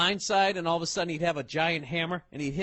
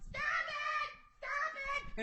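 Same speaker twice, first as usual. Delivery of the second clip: very high squeaky shriek, softer; high-pitched mock scream of a panicked woman; mock screaming lady; 10 dB quieter, much slower than usual. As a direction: shriek like a squeaky dog toy. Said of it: "she's going".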